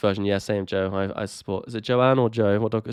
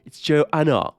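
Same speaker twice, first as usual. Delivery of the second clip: posh indignation; 6 semitones higher, slower than usual. Acted. Haughty mock Joanne.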